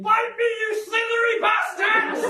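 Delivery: high, drawn-out mock scream. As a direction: scream it slowly like someone unhinged daring a tree. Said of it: "standing in front of a tree screaming".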